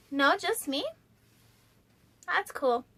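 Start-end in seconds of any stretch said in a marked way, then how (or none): none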